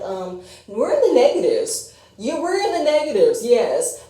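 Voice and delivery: mock smug math. Mocking Audra's math.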